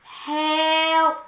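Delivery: loud, drawn-out mock plea; hammed-up distress call; calling for help. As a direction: stretch out a playful cry for help.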